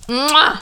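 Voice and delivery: exaggerated smacking "mwah"; chef's kiss. Raving about nineties music, a chef's kiss smack. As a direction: kiss the air with a high, emphatic mwah.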